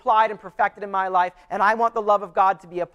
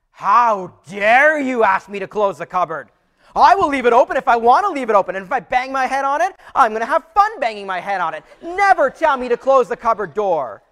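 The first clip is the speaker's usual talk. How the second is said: hammed-up outraged husband, louder and higher; mock angry yell; 4 semitones higher, 7 dB louder than usual. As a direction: pretend to blow up over a cupboard. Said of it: "yell at her".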